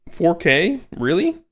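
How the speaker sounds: mocking Randy. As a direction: talk down to gamers, scoffing. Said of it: "He's calling people, like, ignorant".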